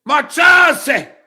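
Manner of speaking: high, loud shout in mock Spanish; yelling. Real, not acted. Streamer banishes delivery driver in Spanish, yelling. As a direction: shout them away from the door. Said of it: "[name]'s yelling at".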